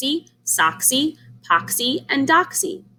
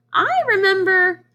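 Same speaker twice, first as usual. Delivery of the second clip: high goose voice runs on; lightly voiced fussy goose; 12 semitones higher, 4 dB louder than usual. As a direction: keep the goose squeak going. Goose voice continues.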